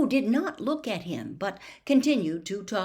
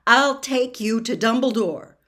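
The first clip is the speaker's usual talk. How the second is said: louder, higher, firm and distinct; boy urging a dazed man to stand; loud and clear; 4 semitones higher, 9 dB louder than usual. A narrator's reading. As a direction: speak up so he understands. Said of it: "loudly and clearly".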